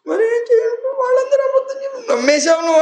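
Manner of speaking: put-on a little boy faking tears to melt his mother; whiny crying child. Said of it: "ఏడుస్తూ ఉంటే". (చాలా పైస్థాయిలో ఏడుపు గొంతుతో పిల్లవాడి మాట)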